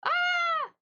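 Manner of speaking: sharp high yelp of pain; sudden cry at the cold; brain freeze yelp. Real, not acted.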